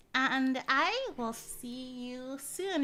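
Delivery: slow farewell. Real, not acted. Drawn-out farewell.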